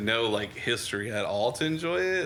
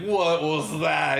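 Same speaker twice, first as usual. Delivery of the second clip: slow, higher, louder "What was that?"; a put-on baffled viewer; mock disbelief; 6 semitones higher, 4 dB louder, much slower than usual. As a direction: drag out the baffled question. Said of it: "it'd be like".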